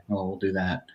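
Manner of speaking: usual speaking voice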